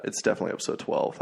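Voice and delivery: fast unvoiced mutter under the breath; a quick self-correction muttered aside; muttered to himself. Real, not acted. Host muttering to himself about the episode count, fast and unvoiced.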